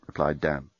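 usual speaking voice

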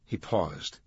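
usual speaking voice

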